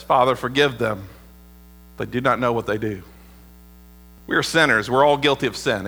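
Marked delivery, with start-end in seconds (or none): 0.0-3.0 soft and low, a gentle plea to the Father; tender prayer. Acted. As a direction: lower the voice and pray it tenderly. Jesus' words to us from the cross.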